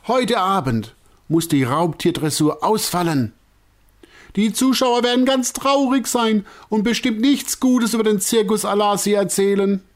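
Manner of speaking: high, loud lament; wailing director. A narrator's reading. Director lamenting to circus folk, high and loud. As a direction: wring your hands out loud in a high voice. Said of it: "sein lautes Jammern".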